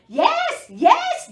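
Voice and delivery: very high, loud eager duck; quacking duck. Acted. Duck answering the dog, very high loud quack. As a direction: squawk yes up high. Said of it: "Duck quacked".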